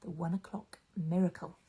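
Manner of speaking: soft, hushed bedtime introduction; hushed story intro. Acted. Storyteller to children, hushed and soft. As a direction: ease listeners in gently.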